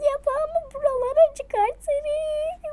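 squeaky high strained voice; slow, strained squeal; weird high voice. Pretend. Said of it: "Garip sesleri çıkarmayı bırak".

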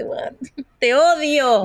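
slow, high, laughing "te odio"; playful slow cry of hatred at a friend; laughing mock hate. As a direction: laugh through a fake curse. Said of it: "y uno dice".

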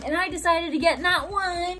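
ordinary talk

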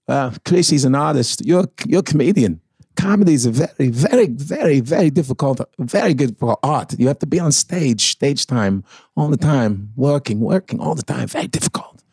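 lightly voiced New York Walken, pausing; grounded Walken with a New York accent. Shown as a demonstration. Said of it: "his New York accent".

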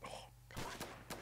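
quiet unvoiced whisper. Real, not acted. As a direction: breathe it under your breath. Sniping, whispered to himself.

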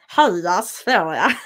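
slow, exaggerated Stockholm Swedish on Halla Sverige; exaggerated Stockholm accent; mock Stockholm accent. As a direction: lampoon a Stockholm accent, drawn out and laughing. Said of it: "as the Stockholms would say".

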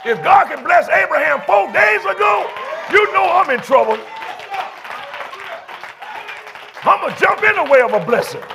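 high, shouted church preaching; full-force preaching. Real, not acted. Pastor rallies weary congregation to praise, full-force preaching.

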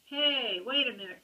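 loud, high call after him. Acted. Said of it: "called Too Tall".

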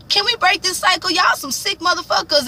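fed up with the whole cycle; Jamal disgusted. Retold or imitated. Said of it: "Jamal was like".

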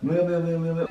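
high little cartoon squeaks; rat's meowing. Shown as a demonstration. Director shows the rat running up, squeaking. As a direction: squeak out quick running meows. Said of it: "he runs up and goes".

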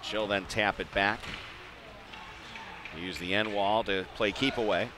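ordinary talk

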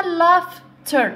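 "laughter" slowly, broken into laugh and ter; split into syllables. Shown as a demonstration. Teacher counting syllables for learners, "laughter" split in two. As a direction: say "laughter" in two separate beats. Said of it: "عندنا two syllables".

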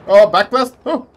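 sudden loud warning yell; alarmed shout. Real, not acted. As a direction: bark out the backblast warning. Backblast warning shouted in combat.

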